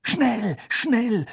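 desperate plea to hurry; moaned urging. Acted. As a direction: groan it anxiously, pitched high. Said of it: "stöhnte Hermine".

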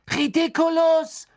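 high shouted spell; Pavati. A narrator's reading. Pavati casting at the mummy, shouting. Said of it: "schrie Pavati".

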